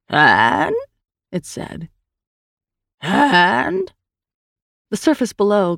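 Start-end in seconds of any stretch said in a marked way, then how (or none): 0.1-0.7 low cry lifting at the end; hissing cry. A narrator's reading. Creature calls out from the darkness, hissing cry. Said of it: "a hissing rush and then a cry". 3.0-3.8 creature's call, high and rising at the end; cry arcing upward. A narrator's reading. Creature calls again from the darkness, upward-arcing cry. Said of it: "arcing upward at the end".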